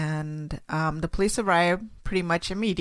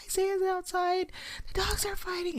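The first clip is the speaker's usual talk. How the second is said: imitating a frightened child, pitched up but quiet; mock panicked child; 12 semitones higher, 6 dB quieter than usual. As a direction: retell the kid's panic lightly, high and hushed. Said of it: "she's screaming in the phone".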